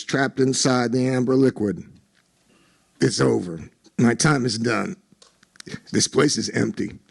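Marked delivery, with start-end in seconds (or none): 3.0-7.0 soft, low, defeated; resigned. A narrator's reading. Owner giving up on his restaurant, soft low resigned. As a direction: let it out with no fight left.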